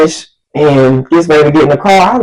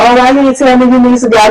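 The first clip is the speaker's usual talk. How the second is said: higher and fast, a mildly hammed-up whiny guy; mock date voice; 8 semitones higher, faster than usual. Date caught off guard at the gas pump, mock whiny and quick. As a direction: toss it off quick and high like a put-out date.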